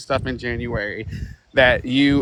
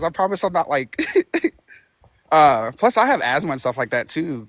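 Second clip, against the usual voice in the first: normal talking voice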